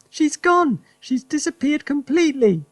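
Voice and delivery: high, wailing wife voice; wife crying out in dismay; crying Missus Cranky. Acted. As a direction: cry it out high. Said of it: "cried Missus Cranky".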